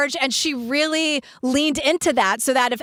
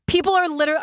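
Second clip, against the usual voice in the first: usual speaking voice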